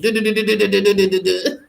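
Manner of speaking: laughing du-du-du drum roll; vocal drum roll. Pretend. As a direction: rattle off a mouth drum roll. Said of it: "that's my version of a drum roll".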